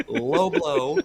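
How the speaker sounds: ordinary talk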